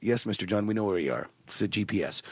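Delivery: low, soft, subdued agent voice; reply like an unruffled call-centre agent; OnStar operator. Acted. Skit, voicing OnStar operator.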